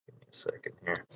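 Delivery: hushed aside to the room; whispered aside. Real, not acted. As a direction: whisper it off mic. Aside to someone off mic.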